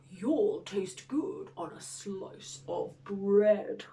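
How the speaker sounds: slow, low, whispery beast voice; hungry creature's hushed threat; menacing monster. Acted. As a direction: whisper it slowly, relishing the meal. Gruffalo threatens to eat mouse.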